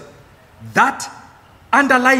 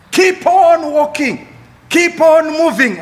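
high, slow preacher's rallying call; rousing exhortation; 6 semitones higher, 4 dB louder, slower than usual. Real, not acted. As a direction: urge the congregation onward, drawing out each command.

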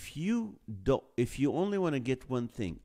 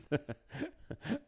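scary laugh; 8 semitones higher, 3 dB quieter than usual. Pretend. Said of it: "scary laugh".

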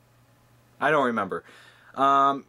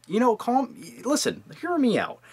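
normal talking voice

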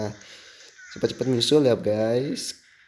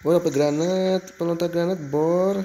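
excited; 7 semitones higher, 3 dB louder than usual. Real, not acted. Finds grenade launcher.

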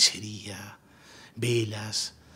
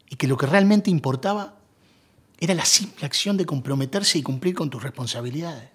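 louder, higher, pressing the point home; impassioned insistence; 3 semitones higher, 8 dB louder than usual. Acted. Man justifying his marriage to his old love, impassioned. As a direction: argue it with rising conviction. Acted confession, arguing for commitment.

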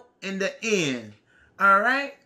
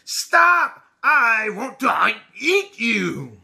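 pig yelling at the cookie, mildly voiced; lightly voiced hungry pig shouting at the ninja bread man; much slower than usual.

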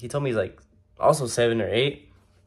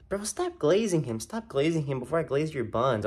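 put-on kid's voice; 5 semitones higher, 3 dB quieter than usual. Retelling the kid behind him in line, high kid voice. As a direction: squeak it out like a bratty little kid in line. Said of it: "all I hear is".

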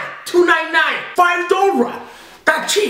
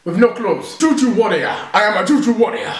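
hammed-up African accent; 2 semitones lower than usual. Son trying to beat his African mum, exaggerated African accent. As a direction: introduce yourself in a mock African accent. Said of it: "African accent".

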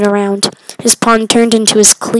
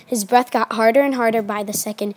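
plain reading voice

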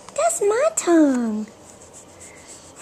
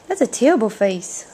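usual speaking voice

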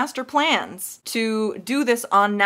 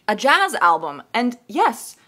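normal talking voice

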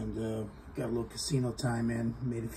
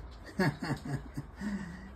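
short scornful laugh at Tony; mocking laugh; 4 semitones higher, 6 dB quieter than usual. Real, not acted. Laughing at Tony's claim of a mob nickname, scornful.

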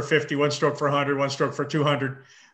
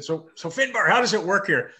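mock brash American accent; hammed-up loud American accent, higher; 6 semitones higher, 4 dB louder than usual. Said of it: "in my best American accent in Ireland".

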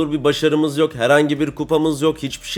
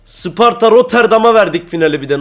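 higher and louder, full of disbelief; indignant outcry; 6 semitones higher, 6 dB louder than usual. Real, not acted. Gamer ranting to viewers about the lost final, indignant outcry. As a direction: complain loudly as if it is an outrage. Fuming over losing to Sparta.

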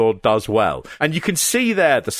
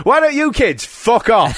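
higher, hammed-up scolding; pitched-up comic outburst; mock telling-off; 8 semitones higher, 4 dB louder than usual. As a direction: imitate a relative shooing the kids away. Mimicking a stock British family quip.

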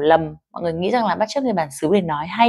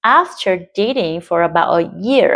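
slowly with stress on "dating" and "year"; slow model reading. Shown as a demonstration. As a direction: read slowly and clearly. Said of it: "Có được cái phần nhấn ở đấy". (đọc mẫu chậm rãi)

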